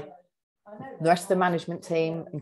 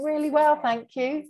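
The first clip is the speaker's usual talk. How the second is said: high, over-bright retold reply; brittle cheerfulness; 11 semitones higher, 4 dB louder than usual. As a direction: put on being fine, pitched way up. Her reply before breaking down.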